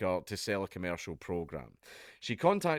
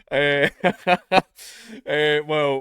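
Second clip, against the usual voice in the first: still laughing; 6 semitones higher, 12 dB louder, much slower than usual. Real, not acted. Recovering from laughter.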